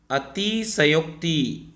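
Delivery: अतिशयोक्ति drawn out slowly, each syllable clear; slow teacher enunciation; slow careful word. Shown as a demonstration. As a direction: say अतिशयोक्ति slowly so they catch it. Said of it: "इसको लगाओगे गलत हो जाएगा".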